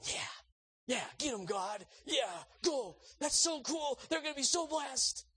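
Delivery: high-pitched giddy cheering; root for them with rising glee; [name] cheering. Acted. Friend watches blessing unfold in drive-through, high excited cheering.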